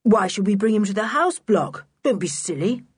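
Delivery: lightly hurried reply; a touch of haste. A narrator's reading. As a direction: get it out hastily, brushing it off. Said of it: "He spoke hurriedly".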